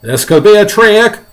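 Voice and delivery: high, loud character voice; shouted warning. Acted. Fire chief suspecting a trick, high shouted warning. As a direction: warn them at full volume.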